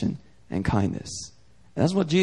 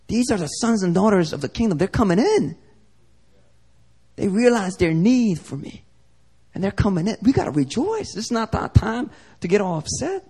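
acted earnest appeal, raised pitch; Jesus; 5 semitones higher than usual. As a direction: urge them in a higher, insistent voice. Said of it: "And Jesus is like".

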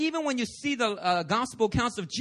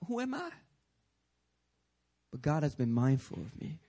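humble wonder; 7 semitones lower, 5 dB quieter than usual. Acted. Mary's humble wonder, acted.

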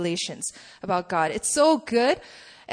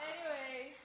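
usual speaking voice

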